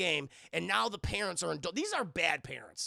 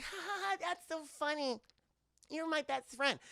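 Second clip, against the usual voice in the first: put-on high, soft child's voice; imitating a little girl chatting with an invisible friend; 8 semitones higher, 4 dB quieter than usual. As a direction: giggle like a small girl.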